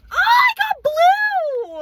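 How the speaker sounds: high squealing cry of joy; excited squeal. Real, not acted. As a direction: shriek with delight at the reveal. Thrilled reveal of blind box.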